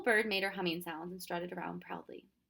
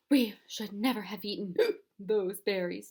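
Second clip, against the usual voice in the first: words broken by gasps for air; gasping; 5 dB louder than usual. Acted. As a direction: gasp between the words as if out of breath. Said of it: "He gasped".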